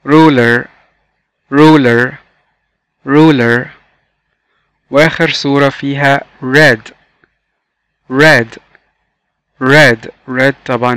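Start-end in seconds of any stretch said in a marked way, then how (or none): 0.1-3.7 RULER said alone, firmly and clearly; model pronunciation. Shown as a demonstration. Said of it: "مرة كمان". 8.1-8.6 RED said alone, firmly and clearly; model pronunciation. Shown as a demonstration. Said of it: "وعرفنا بننطق إزاي حرف ال R".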